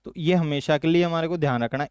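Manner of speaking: usual speaking voice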